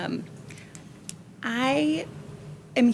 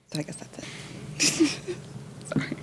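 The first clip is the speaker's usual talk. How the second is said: nervous laugh; 9 semitones higher, 7 dB quieter than usual. Real, not acted. Nervous speaker ends her remarks.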